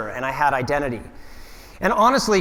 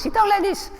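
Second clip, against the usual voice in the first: put-on very high, squeaky Italian-accented voice; squeaky Italian climber. Mocking his Italian climbing partner, very high and squeaky. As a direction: pitch way up into a tiny squeaky voice. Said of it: "who has a very high-pitched voice".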